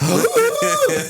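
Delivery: sharp high thrilled gasp; excited gasp. Retold or imitated. Av geek invited into the cockpit, gasping with joy. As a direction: gasp like a kid who just got the best news. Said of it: "I fucking screamed like bloody murder, like a little girl just super excited".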